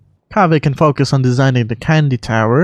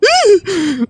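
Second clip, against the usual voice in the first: startled gasp. Acted. Creature startled by question.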